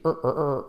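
ER with tongue tip curled up; English R drill. Shown as a demonstration. Teacher showing the key letter R, repeated ER. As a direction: repeat ER as a held R sound. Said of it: "Punta la lengua arriba".

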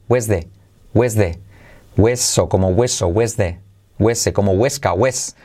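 ordinary delivery

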